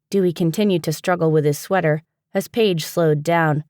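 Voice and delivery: plain reading voice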